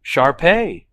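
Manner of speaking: "Shar-Pei" high and loud, swooping up on Pei; copied pronunciation. Acted. Narrator copies the dog owner, exaggerated high "Shar-Pei". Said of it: "I try to say it the same way he does".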